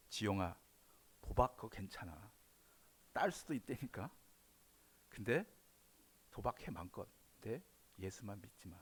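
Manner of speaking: devil whispering. Acted. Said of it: "요렇게 속삭인다니까요".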